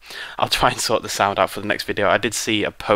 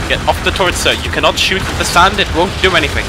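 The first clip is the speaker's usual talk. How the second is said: loud, raised-pitch scolding; exasperated yelling; 8 semitones higher, 8 dB louder than usual. Real, not acted. Player ordering a soldier off the turret, exasperated yell. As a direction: yell orders over the noise.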